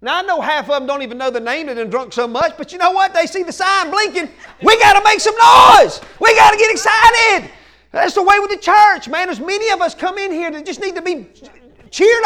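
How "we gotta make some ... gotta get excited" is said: loud, high rallying yell; fired-up crowd shout. Retold or imitated. Fans seeing the sign blinking, fired-up shouting. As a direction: yell like a fan whipping up the stands.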